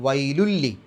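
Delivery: വൈലുല്ലി with the noon merged into a doubled lam; merged recitation. Shown as a demonstration. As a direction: say വൈലുല്ലി, tanween fully absorbed into the lam. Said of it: "വൈലു എന്ന് പറഞ്ഞിട്ട് ഷദ്ദുള്ള ലാമ് പറഞ്ഞാൽ മതി".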